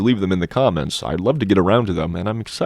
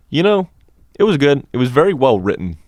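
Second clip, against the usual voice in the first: high, louder put-on reviewer voice; reviewer persona; 6 semitones higher, 3 dB louder than usual. Host in his reviewer persona giving his verdict, high and bright. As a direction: play the chirpy book critic, pitched up. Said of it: "Young Sleepless Tales is telling you".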